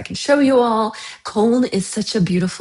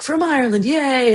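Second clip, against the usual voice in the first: higher-pitched mock excitement; mock cheering; 7 semitones higher than usual. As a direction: imitate gushing cheese hype with a little yay. Mimics hype for imported cheese.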